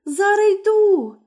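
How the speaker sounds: wife stalling the villain; frightened wife. Acted. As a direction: call back quickly to stall. Wife answers.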